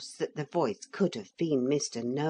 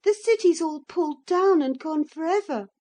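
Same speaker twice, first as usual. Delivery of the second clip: high, loud voice on the edge of tears; boy protesting through tears; desperate; 12 semitones higher, 7 dB louder than usual. A narrator's reading. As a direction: cry out in disbelief. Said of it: "said Philip desperately".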